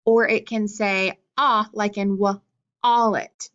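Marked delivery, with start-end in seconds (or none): 1.4-3.5 wallet slowed, ah held open; short O demo. Shown as a demonstration. Teacher showing the ah sound, slow and open. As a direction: stretch the ah in wallet. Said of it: "that short O sound".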